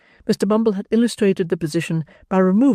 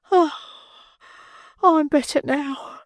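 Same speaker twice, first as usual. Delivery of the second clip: very high, slow sighing lady; recovering matron; 13 semitones higher, slower than usual. Acted. Matron relieved after drinking, high and slow. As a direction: breathe it out with relief.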